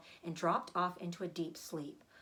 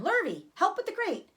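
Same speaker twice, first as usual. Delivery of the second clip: much higher and louder shout; 11 semitones higher, 5 dB louder than usual. Acted. Zuckerman shouts to hurry as the award is announced. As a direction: bark the urgency out. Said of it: "shouted Mister Zuckerman".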